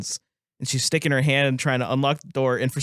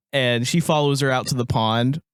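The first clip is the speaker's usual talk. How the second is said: normal talking voice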